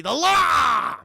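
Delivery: high, loud, drawn-out villain bellow; Armand Assante. Retold or imitated. Mimicking how he talks all movie, weird bellowed voice. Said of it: "He talks like this throughout the entire movie".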